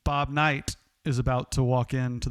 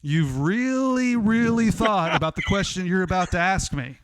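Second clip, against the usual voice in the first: Bob Knight; 5 semitones higher, 4 dB louder than usual. Retold or imitated. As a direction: lean on "really" and dare him to ask. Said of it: "Bob Knight interrupts him and says".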